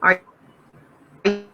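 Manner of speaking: normal talking voice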